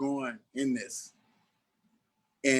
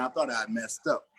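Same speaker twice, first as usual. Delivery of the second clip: ordinary talk, nothing marked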